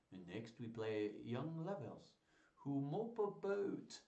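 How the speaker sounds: hammed-up French accent. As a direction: put on a theatrical French accent. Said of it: "get through this in a French accent".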